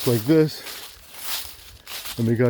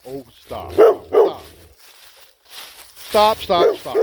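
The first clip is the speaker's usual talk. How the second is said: talking to a dog; 9 semitones higher, 4 dB louder, much slower than usual. Real, not acted. Dog jumping up.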